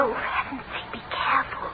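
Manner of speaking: whispered, urgent caution; hushed warning. Real, not acted. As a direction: warn him under your breath. Sneaking through door, hushed warning.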